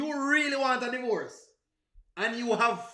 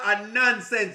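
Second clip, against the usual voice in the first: normal talking voice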